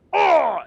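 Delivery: high loud yelp of pain; ogre's pained yelp. Acted. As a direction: cry out as the boot lands. Ogre gets kicked.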